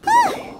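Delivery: relieved whew. Acted. Paimon finishing training.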